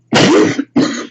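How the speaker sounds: short dry cough; real cough. Real, not acted. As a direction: cough once. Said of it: "Excuse me".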